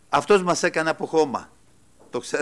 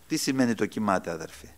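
ordinary talk, nothing marked

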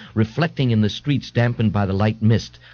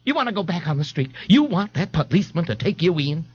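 higher, lightly voiced scolding mother; 8 semitones higher than usual. A narrator's reading. A mother warns her son about the policeman, scolding. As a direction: press the warning as a pointed question.

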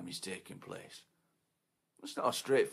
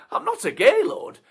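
much higher, loud protest; squeaky denial; 17 dB louder than usual. Acted. Bloke protesting.

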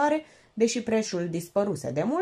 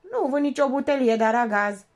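parrot voice; 5 semitones higher, 5 dB louder than usual. Acted. Parrot repeating.

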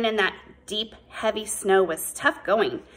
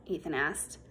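plain reading voice

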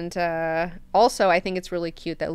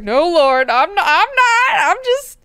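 panicked backpedaling; 14 semitones higher, 10 dB louder than usual. Retold or imitated. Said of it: "Mineo's like".

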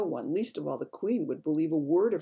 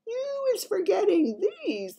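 high, slow playful giant; giant's whisper; 6 dB louder, slower than usual. A narrator's reading. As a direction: tease her gently as the giant. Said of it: "the B F G whispered".

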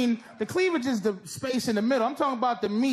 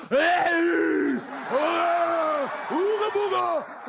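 hammed-up primitive catcall; mock caveman catcall; 6 semitones higher, much slower than usual. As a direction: caveman "ooga booga", shouted in jest. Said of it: "I'm not talking about".

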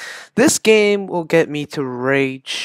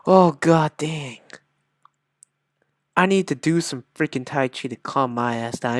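usual speaking voice

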